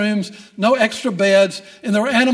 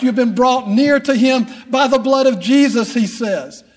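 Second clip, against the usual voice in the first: preaching; 3 semitones higher, 3 dB louder than usual. Acted. Preaches to the congregation.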